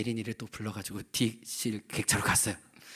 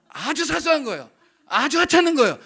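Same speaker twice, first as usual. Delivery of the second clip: higher, louder, laughing over the trivial fight; a higher, laughing exaggeration; laughing mock insistence; 12 dB louder than usual. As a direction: ham up how petty it was. Insists comically it was trivial.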